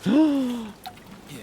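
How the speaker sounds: startled high gasp; a sudden fright intake of breath; sharp gasp. Real, not acted. Startled in horror game.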